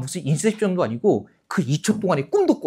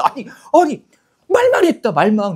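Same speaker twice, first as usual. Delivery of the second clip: loud, high-pitched pleading of innocence; mock flustered self-defence; defensive protest; 8 semitones higher, 6 dB louder than usual. Teacher defending themselves to the director, high flustered protest.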